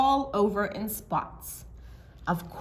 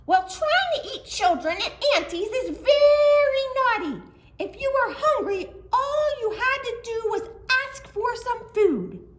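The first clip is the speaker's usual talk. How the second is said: put-on little girl voice; 5 dB louder than usual. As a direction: coo it like a cheeky child.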